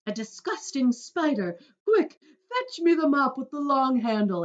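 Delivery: loud, high disgusted orders; loud disgusted cry. Retold or imitated. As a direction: bark for the mop in revulsion. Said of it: "she cried".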